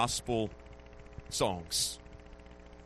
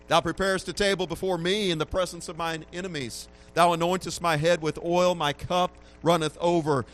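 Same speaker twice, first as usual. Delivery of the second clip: rising, louder preaching of the psalm; scripture reading; 5 semitones higher, 4 dB louder than usual. Acted. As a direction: proclaim the psalm with growing force. Reads Psalm twenty-three aloud.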